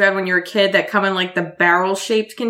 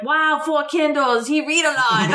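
put-on slow, higher accented doctor voice; lightly done Chinese accent; imitating a Chinese accent; 6 semitones higher, slower than usual. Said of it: "her accent's quite strong".

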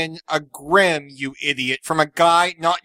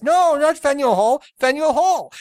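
mock Japanese accent; 9 semitones higher than usual. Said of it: "very thick accents".

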